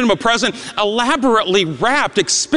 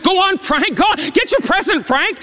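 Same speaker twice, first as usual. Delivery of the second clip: teasing schoolboy; 8 semitones higher than usual. Retold or imitated. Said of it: "Stuart said".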